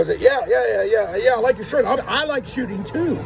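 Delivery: high-pitched imitation of gushing agreement; mock eager agreement. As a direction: rattle off the yeahs high, mimicking someone keen to fit in. Mimics strangers eagerly agreeing with him.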